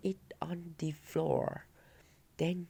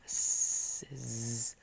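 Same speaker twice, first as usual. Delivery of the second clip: snoring; 3 semitones lower than usual. A narrator's reading. Wolf snoring.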